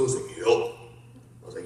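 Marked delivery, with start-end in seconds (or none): 0.4-0.7 low, booming phone answer; big trucker voice. Retold or imitated. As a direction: answer loudly as a hulking trucker. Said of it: "this big trucker from the U S".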